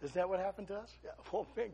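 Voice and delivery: ordinary talk, nothing marked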